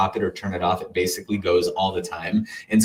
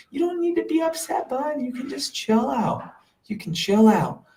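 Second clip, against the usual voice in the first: higher self voice; 12 semitones higher than usual. Retold or imitated. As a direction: gently comfort your anxious little self, pitched up and hushed. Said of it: "I came in as my higher self and I said".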